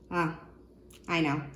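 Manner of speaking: ordinary talk, nothing marked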